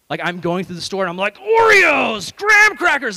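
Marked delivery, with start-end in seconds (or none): 1.4-3.2 hammed-up furious yell, high and very loud; angry shout. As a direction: bellow it like a furious shopper. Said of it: "I'm angry".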